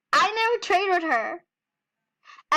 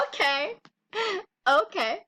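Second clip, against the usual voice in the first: usual speaking voice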